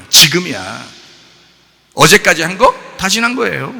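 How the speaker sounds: loud, high-pitched scolding from the pulpit; scolding sermon. Real, not acted. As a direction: preach it as a forceful rebuke, driving up to a shout. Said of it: "좀 혼내는 겁니다". (목청 높여 꾸짖는 설교)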